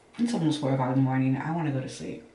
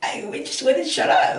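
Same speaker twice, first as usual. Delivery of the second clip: much higher, louder exasperated cry of "wouldn't shut up"; exasperated outburst; 11 semitones higher, 6 dB louder than usual. Real, not acted. Telling viewers her racing thoughts wouldn't stop, exasperated loud. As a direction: blurt it out, fed up with racing thoughts. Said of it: "my thoughts just wouldn't shut the fuck up".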